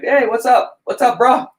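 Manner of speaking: high, casual bro greeting; breezy friendly hello; mild, easygoing person. Acted. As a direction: say hi like a laid-back dude. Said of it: "you got a mild".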